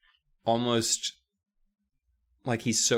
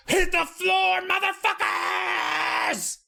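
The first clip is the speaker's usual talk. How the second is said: mock shouted stick-up; much slower than usual. Telling the kids her plan, a high hammed-up bank robber shout.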